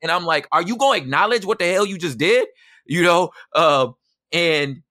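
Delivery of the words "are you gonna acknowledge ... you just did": indignant. Retold or imitated. Confronting wife, retold.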